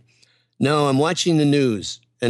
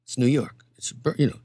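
low, quiet mock coaching tone; a hint of Tony coaching, murmured low; imitating Tony; 6 semitones lower, 4 dB quieter than usual. Tony coaching him in the makeup chair, lightly imitated. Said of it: "and he says".

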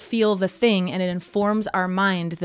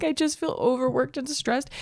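mock stressed voice; 8 semitones higher than usual. Imitating a Queer Eye guest for a cohost, high whine. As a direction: whine it out, overworked and drooping. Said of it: "talking like this".

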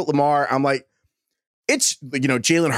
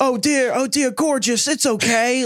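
high, soothing mock chef voice to a child; imitating the chef soothing a five-year-old; gentle Gordon Ramsay impression; 9 semitones higher, slower than usual. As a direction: coo it like a kind TV chef comforting a little girl. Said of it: "that meme of Gordon Ramsay".

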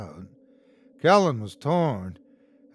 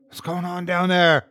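loud, raised call down the stairwell; shout; 4 semitones higher, 4 dB louder than usual. A narrator's reading. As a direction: shout down to the floor below, worried. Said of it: "Dante shouted".

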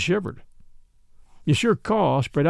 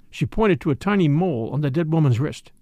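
usual speaking voice

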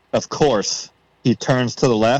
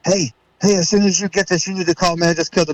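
excited voicemail; 7 semitones higher, faster than usual. Retold or imitated. Urgent voicemail to his dad.

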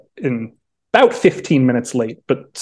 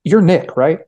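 higher, louder, shy and hopeful; imitating a fan who bashfully tries a stranger's name; bashful question; 5 semitones higher, 3 dB louder, slower than usual. A TV reporter bashfully stops the writer, imitated shyly. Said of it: "just kind of bashfully goes".

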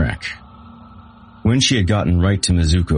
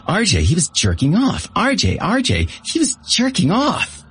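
rising higher and higher, frantic; hysterical, higher with each repeat; giddy shock. A narrator's reading. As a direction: squeal it out, bouncing in place. Bate bouncing, shocked and giddy.

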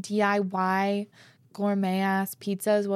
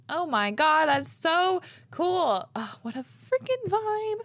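excited gushing; 9 semitones higher, 3 dB louder, slower than usual. Real, not acted. Reacting to Christmas tree tradition.

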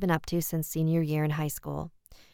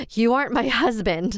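higher, louder cry of fear; horrified wife facing a demon; terrified accusation; 6 semitones higher, 5 dB louder than usual. Acted. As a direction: back away from the impostor. Realises husband is a demon.

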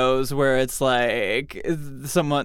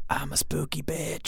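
spooky ghost voice; 8 dB quieter than usual. Acted. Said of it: "is it A".

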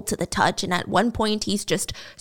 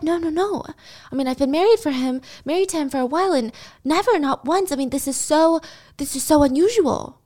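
higher-pitched, earnest insistence; acted bewildered wife, pitched up; baffled denial; 6 semitones higher than usual. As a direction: deny it with rising disbelief. Wife denying, acted in story.

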